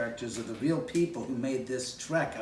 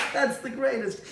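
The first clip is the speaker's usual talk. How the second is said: amused; 11 semitones higher, 3 dB louder than usual. Retold or imitated. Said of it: "he looked at me and he goes".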